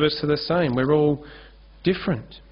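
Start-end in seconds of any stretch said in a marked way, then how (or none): none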